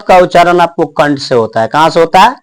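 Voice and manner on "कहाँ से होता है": ordinary talk, nothing marked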